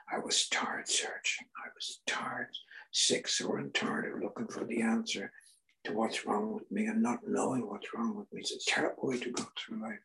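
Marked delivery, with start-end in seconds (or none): 0.1-7.7 breathy, worn-out whisper; a quiet, spent admission; weary whisper. Real, not acted. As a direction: let it drop to a tired whisper. Weary confession after friend's words.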